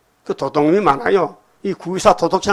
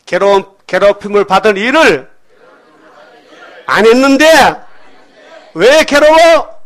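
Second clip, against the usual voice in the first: building shout; 5 semitones higher, 8 dB louder than usual. Real, not acted. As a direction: build up and hold the last question at full voice. Preacher builds to shouted question.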